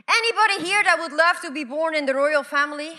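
ordinary talk

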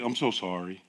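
low, soft, grave apology; somber doctor. Retold or imitated. Doctor apologising to his patient before bad news, low and soft.

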